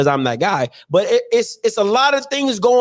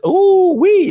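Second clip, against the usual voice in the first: excited whoop; 9 semitones higher, 5 dB louder than usual. Real, not acted. Dreaming of spending the budget.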